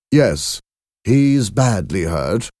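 usual speaking voice

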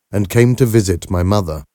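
ordinary delivery, nothing marked

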